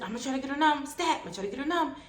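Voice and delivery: high, loud, hurried medical calls; frantic urgency. Retold or imitated. Operating room team rushing emergency surgery, frantic. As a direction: imitate a team racing the clock.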